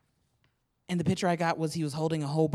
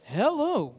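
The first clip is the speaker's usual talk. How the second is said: bright, higher game show "Hello"; game show host; 5 semitones higher than usual. Shown as a demonstration. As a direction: greet like a TV host. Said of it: "wanna do the game show voice too".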